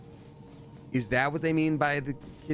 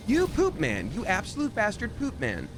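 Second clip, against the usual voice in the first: high, playful angry jab; mock insult; 10 semitones higher than usual. Real, not acted. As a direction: scold the enemy in jest. Enemy catches her in game.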